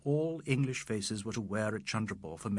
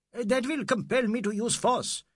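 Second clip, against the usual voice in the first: high-pitched official wail; 11 semitones higher, 4 dB louder than usual. A narrator's reading. Inspector threatening the escaping young man, wailing. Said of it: "Mister Huck wailed".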